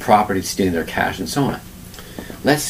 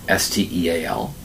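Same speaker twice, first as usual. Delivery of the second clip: usual speaking voice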